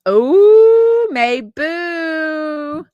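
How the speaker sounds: a sudden loud, high cry of recognition; light up at the name you just spotted; surprised. Real, not acted. Spots a viewer's name in chat.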